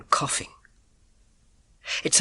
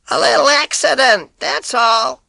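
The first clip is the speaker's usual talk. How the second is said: in her voice; 11 semitones higher, 8 dB louder than usual. Acted. Boy calling to the milkman in the old lady's voice. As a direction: put on the old woman's voice to fool him. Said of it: "in her voice, in her accent".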